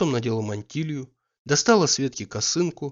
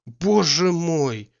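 horrified; 5 semitones higher, 5 dB louder, slower than usual. A narrator's reading. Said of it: "с ужасом сказал он".

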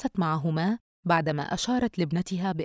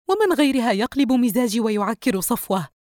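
louder, higher testy complaint; irritated; 5 semitones higher, 5 dB louder than usual. A narrator's reading. Wife complaining to husband about who spoils her mood, irritated. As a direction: grumble sharply at your husband.

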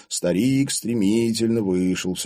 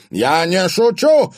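slow, loud, high insistence; exclaimed warning; 12 semitones higher, 9 dB louder, slower than usual. A narrator's reading. Father answers the crowd's grumbling, exclaiming. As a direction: make the crowd believe it. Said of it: "воскликнул Далмацкий".

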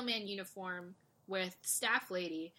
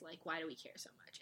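whispered aside; 4 semitones lower, 13 dB quieter, faster than usual. Retold or imitated. Quoting Lil Sis.

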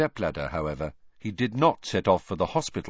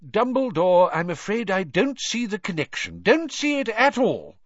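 high, blustering minister; Cornelius Fudge; 12 semitones higher, 3 dB louder than usual. A narrator's reading. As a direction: dismiss it in a high, fussy official's voice. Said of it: "the voice of the Minister for Magic, Cornelius Fudge".

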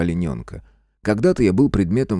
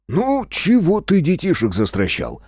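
high, drawn-out reproach; slow, high-pitched dismay; lamenting; 10 semitones higher than usual. A narrator's reading. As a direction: lament it at your friend, stretching the words. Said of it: "сокрушается Геныч".

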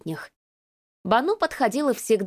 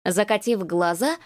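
ordinary delivery, nothing marked

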